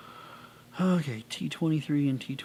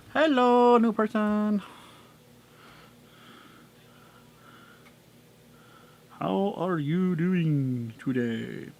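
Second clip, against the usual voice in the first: higher, louder cheery hello; loud greeting; 4 semitones higher, 7 dB louder, slower than usual. Acted. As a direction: call out big and bright. Greeting toy loudly.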